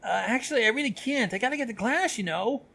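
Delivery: high-pitched, flustered brush-off; nervous excuse. Acted. Student trying to sprint away from Gallus, nervous. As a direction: make a jittery excuse to escape. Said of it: "Ocelus laughed nervously before trying to sprint away".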